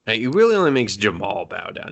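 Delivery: ordinary talk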